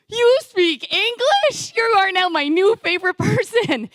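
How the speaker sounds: high, slow, amazed question; relieved traveler, amazed the pastor speaks English; stunned delight. Retold or imitated. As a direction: blurt it out in happy disbelief.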